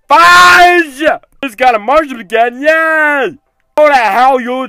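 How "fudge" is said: loud slow exasperated cry; fed-up howl; frustrated yell. Real, not acted. Rage quitting after failing.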